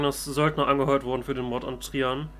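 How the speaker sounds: usual speaking voice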